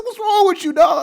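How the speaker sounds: high, loud incredulous friend. Retold or imitated. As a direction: shout it high in disbelief. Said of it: "He said".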